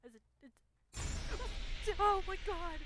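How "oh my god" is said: high, loud shriek at a sudden fright; startled shriek. Real, not acted. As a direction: scream it out in shock. Shriek at a jump scare.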